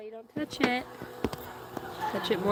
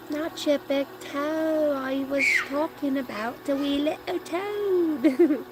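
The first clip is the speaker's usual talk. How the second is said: slow teasing; 5 semitones higher, slower than usual. Acted. Joking correction.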